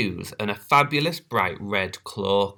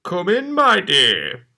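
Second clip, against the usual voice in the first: deep scratchy voice 1; 9 semitones higher, 5 dB louder, slower than usual. Acted. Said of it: "deep, scratchy voice".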